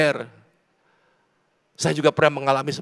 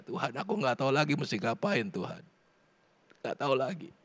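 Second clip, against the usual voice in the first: very soft, helpless plea to God; tearful prayer; 7 dB quieter than usual. Acted. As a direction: plead softly, as if in tears. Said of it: "Datang menangis sama Tuhan". (doa lirih seperti menangis)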